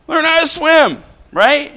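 rising sermon fervour; fervent preaching. Acted. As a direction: preach it out to the pews. Preaching to congregation.

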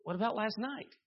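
high, stammering imitation of a puzzled husband; bewildered question, pitched well up; baffled husband. Husband answering his wife in counseling, baffled. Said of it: "He said".

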